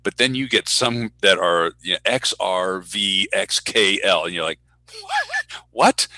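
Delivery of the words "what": high, loud baffled reaction; baffled disbelief. Acted. Announcer faced with an impossible name, baffled. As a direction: act out being stumped.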